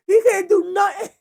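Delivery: high, loud words squeezed through laughter; laughing so hard the words break up. Real, not acted. Host cracking up at his own Lane Griffin joke, laughing through the words.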